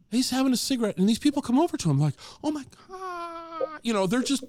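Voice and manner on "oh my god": high, drawn-out swooning squeal; starstruck fan. Retold or imitated. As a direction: imitate a fan gasping in awe, stretched and softer. Imitating starstruck fans meeting him.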